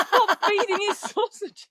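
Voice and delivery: high, loud, slow protest with a laugh; exasperated mock yell. Daughter begging mum over the rat, mock exasperated.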